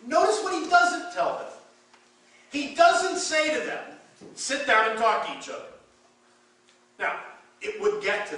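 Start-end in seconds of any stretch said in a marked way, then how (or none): 0.1-5.7 loud, high preacher's push; preaching. Acted. Preacher urging the church to notice Paul's words, loud and high. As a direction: drive the point home to the pews.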